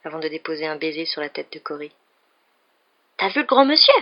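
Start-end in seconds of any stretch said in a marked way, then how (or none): 3.2-3.9 put-on high, loud small child's question; a curious kid tugging for attention; eager little boy. A narrator's reading. Little boy asking his mother about the visitor, eager. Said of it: "lui demanda le petit garçon".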